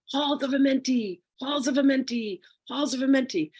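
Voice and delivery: high-pitched mock screaming of excited beings; imitated screaming. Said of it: "and they're screaming".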